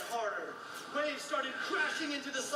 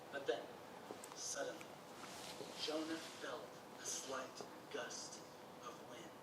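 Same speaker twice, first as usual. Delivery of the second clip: suspense hush; 12 semitones lower, 12 dB quieter than usual. Acted. Storyteller builds suspense.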